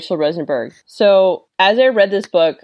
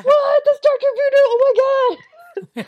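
put-on squealing, much higher, giddy kid; imitating a thrilled teen fanboy; excited fanboy kid. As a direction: gush like a starstruck teen. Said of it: "you can see his excitement".

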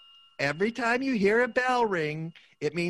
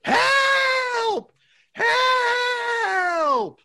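much higher desperate shout; cry for help; 3 dB louder than usual. Acted. Clarence shouting for help from the river, desperate. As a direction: call out as if drowning. Drowning call.

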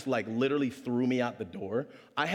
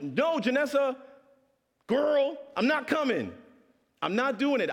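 frustrated rant; 9 semitones higher than usual. Retold or imitated. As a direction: let it all out, exasperated. Frustrated outburst at Janessa.

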